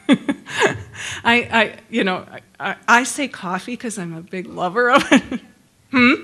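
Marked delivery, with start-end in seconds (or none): none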